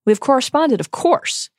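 a hint of courteous character voice, lifted in pitch; Dumbledore. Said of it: "Dumbledore says".

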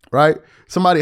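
ordinary talk

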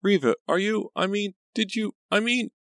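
very high, flustered false starts; sputtering. A narrator's reading. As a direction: sputter in broken high-pitched bits. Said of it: "Pam sputtered".

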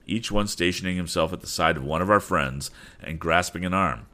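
ordinary delivery

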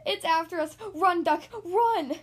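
high, loud cry of alarm; panicked shout. A narrator's reading. Goose urges Duck to flee the monster, panicked shout. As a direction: yell the warning in panic.

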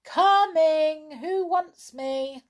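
high, drawn-out answering call; calling back. A narrator's reading. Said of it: "A voice answered from the middle of the wood".

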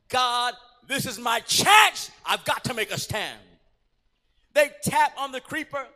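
ordinary talk

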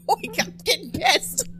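high, squeaky giggling through the words; can't stop laughing, lightly. Real, not acted. Cohost laughing at a grapes joke, giggling helplessly.

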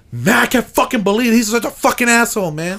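high, incredulous mock outrage; retold indignant rant. Shopper outside cursing on seeing him again, high mock indignation. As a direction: imitate a sore loser griping, pitched well up.

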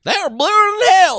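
overdone high-pitched city doctor; snobby doctor voice. Retold or imitated. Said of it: "the kind of snobby voice I went with earlier".

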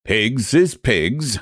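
slow, firm "Pigs is pigs"; stubborn Irish clerk, slow and firm; firm declaration. Acted. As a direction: plant your feet on "Pigs is pigs". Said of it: "he declared firmly".

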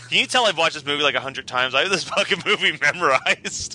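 normal talking voice

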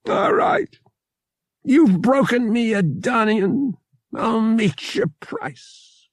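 put-on high, resigned old trader; a hint of a beaten old haggler; defeated old man. A narrator's reading. O'Connor gives in.

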